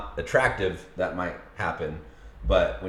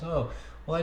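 imitating his wife; 9 semitones higher, 5 dB quieter than usual. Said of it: "She's like".

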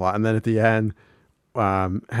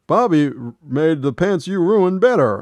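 high, louder mock Hank; Hank impressed; 8 semitones higher, 6 dB louder than usual. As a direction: boom it out in surprise. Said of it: "And Hank's like".

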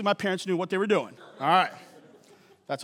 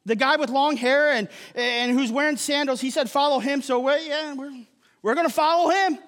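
higher-pitched pretend son breaking news to Dad; mock offhand son; 6 semitones higher than usual. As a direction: play a son cheerfully abandoning the family business. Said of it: "you go home and you say".

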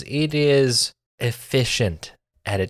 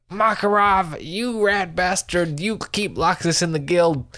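indignant Natsu; 7 semitones higher than usual. Retold or imitated. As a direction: voice Natsu calling Makarov a rat bastard, pitched up. Natsu voiced in anime retelling.

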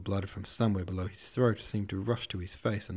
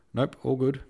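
forced breezy; 5 semitones higher, 6 dB louder than usual. Acted. Forced denial.

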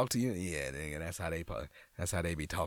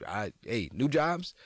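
usual speaking voice

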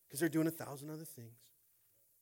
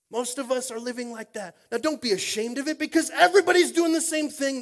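louder, higher repeat; preaching, building; 8 semitones higher, 14 dB louder than usual. Acted. Pastor presses the point on the congregation, rising. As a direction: lift it into a rising shout.

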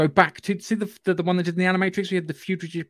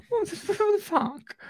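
high, slow, stunned disbelief; mock bewildered outburst; baffled what the fuck; 13 semitones higher, 4 dB quieter, slower than usual. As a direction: gape at the screen in disbelief. Baffled reaction to the film.

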